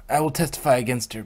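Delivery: loud, fast, determined vow; firm resolve. A narrator's reading. Stallion vowing to testify against his captor, loudly. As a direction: declare it with force.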